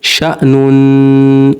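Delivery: शा नून with a long nasal hum on the न, very slow; ghunna on nun. Shown as a demonstration. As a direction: hum the नून through the nose. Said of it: "पर गुन्ना होगा". (शा नून में गुन्ना, नाक से लंबा)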